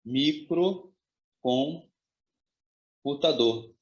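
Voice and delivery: very slow, word in separate chunks; spell-it-out pace with long gaps; slow dictation. Shown as a demonstration. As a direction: dictate it piece by piece, drawn out. Writes out the joined word.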